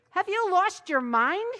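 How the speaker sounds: shrill high scream. A narrator's reading. Wife screaming at her confused husband, loud and high.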